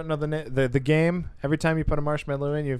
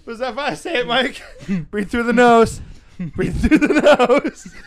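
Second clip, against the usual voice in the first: cheering on; 6 semitones higher, 6 dB louder than usual. Real, not acted. Coaching friend on the challenge.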